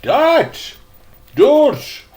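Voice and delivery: put-on a girl corrects the boy, impatient; impatient repeat. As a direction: snap the word back twice, irritated. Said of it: "she repeated impatiently".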